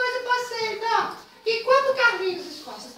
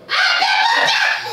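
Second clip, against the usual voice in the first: slow laughing shout, much louder and higher; 8 semitones higher, 6 dB louder, much slower than usual. Acted. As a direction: yell it slowly through laughter.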